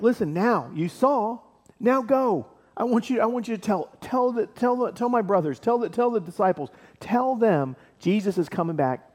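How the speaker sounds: high-pitched, eager urging; acted angel hurrying messengers on their way; urgent instructions. Angel urgently sends women off.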